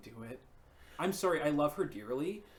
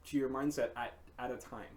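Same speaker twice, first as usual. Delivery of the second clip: ordinary talk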